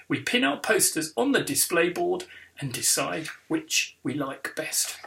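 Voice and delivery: ordinary delivery, nothing marked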